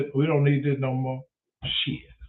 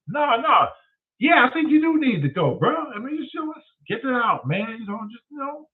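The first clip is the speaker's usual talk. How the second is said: urgent nudging; 9 semitones higher than usual. Retold or imitated. Urging friend to bathroom talk.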